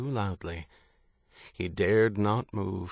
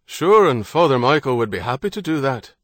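loud, hearty Irish man calling out; a confident Irishman hailing strangers; bold Irish traveller; 4 semitones higher, 7 dB louder than usual. Acted. Harrody answers loudly.

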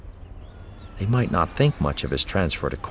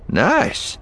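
pleased; 11 semitones higher than usual. A narrator's reading. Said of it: "Eli said".

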